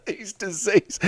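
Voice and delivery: real laugh breaking through the words; helpless laughter. Real, not acted. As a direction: crack up while trying to speak. Cracks up at own word.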